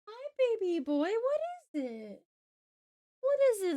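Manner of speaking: high cooing pet voice. Real, not acted. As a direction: coo up high at the puppy. Cooing to her dog.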